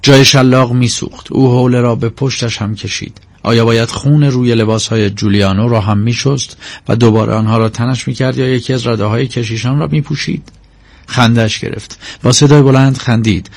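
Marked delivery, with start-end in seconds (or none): none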